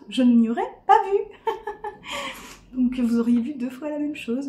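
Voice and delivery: normal talking voice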